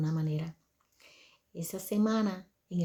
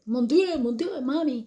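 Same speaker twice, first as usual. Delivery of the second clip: high, loud French exclamation; French outburst, pitched up and louder; speaking French; 6 semitones higher, 6 dB louder, slower than usual. Retold or imitated. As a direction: imitate the son crying out in French. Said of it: "Él salió del cuarto hablando, ah, francés".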